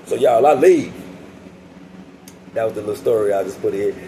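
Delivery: normal talking voice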